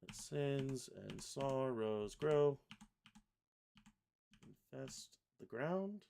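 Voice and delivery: slow, half-chanted lyric; measured carol dictation; syllable-by-syllable reading. Shown as a demonstration. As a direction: hold each syllable as it gets placed. Reads lyrics while pasting syllables.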